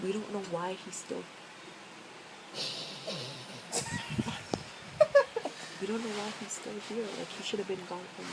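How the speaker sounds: hushed, fast late-night whisper. Real, not acted. Filming late with her siblings asleep nearby, hushed whisper. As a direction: keep it low so nobody wakes.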